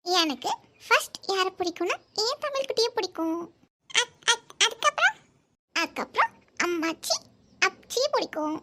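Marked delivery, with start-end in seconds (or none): 3.9-5.2 put-on child voice. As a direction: stammer like a small child. Voicing child.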